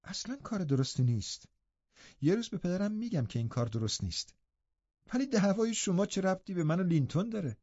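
put-on eager young girl questioning her uncle; a lightly voiced lively girl; young Catherine. A narrator's reading. Catherine voiced in read story.

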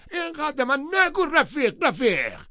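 shouting. Acted. Tom yells at Gatsby to stop calling him رفیق. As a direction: scream "رفیق" back at him. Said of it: "تام فریاد کشید".

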